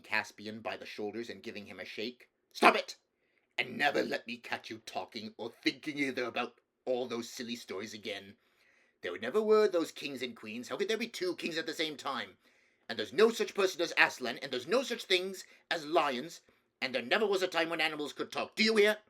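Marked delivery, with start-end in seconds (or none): 2.5-3.0 loud, high burst of temper; furious uncle's order; angry shout. Acted. King shaking his crying nephew, angry shout. 3.6-19.0 raised, forceful tirade building to a shout; angry king. Acted. King forbidding his nephew the old stories, angry scolding.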